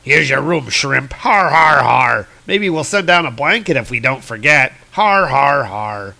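hammed-up mocking giant voice with forced har-har laughs; jeering giants. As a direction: taunt the prisoner like a bullying giant. Giants jeering at their prisoner.

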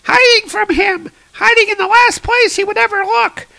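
very high, loud squeaky voice; cartoonish panic far above your own pitch; frightened squid creature. Green squid guy telling Thor why he hides, squealing fright.

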